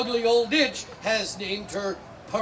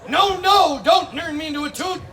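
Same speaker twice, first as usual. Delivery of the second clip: husband yelling; 5 semitones higher, 6 dB louder than usual. Acted. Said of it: "He's yelling".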